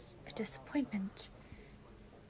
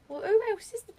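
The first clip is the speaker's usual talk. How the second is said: shocked cry; 9 semitones higher, 7 dB louder than usual. Acted. Shocked cry on seeing someone.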